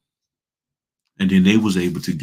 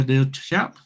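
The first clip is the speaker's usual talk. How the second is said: a quick, higher-pitched touch of British; British accent; 6 semitones higher than usual. Shown as a demonstration. Coach joking about how they talk, little British accent. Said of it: "throw a little British accent in there".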